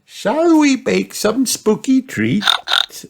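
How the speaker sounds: high, slow granny voice; Granny Pig. A narrator's reading. Granny offers to bake treats, high slow granny voice.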